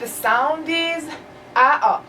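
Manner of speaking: ا and آ said as open a sounds; teacher demonstrating the a sound; vowel sound demo. Shown as a demonstration. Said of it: "the name is A".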